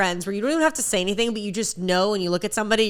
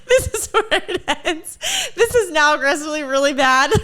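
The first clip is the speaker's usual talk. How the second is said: mock alarm through laughter; 5 semitones higher than usual. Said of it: "And I was like".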